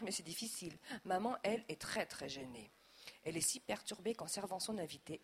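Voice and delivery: usual speaking voice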